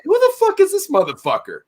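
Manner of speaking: high-pitched retold incredulity; indignant disbelief. Store manager reacting to the rude parker, indignant disbelief. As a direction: pitch it way up in mock outrage.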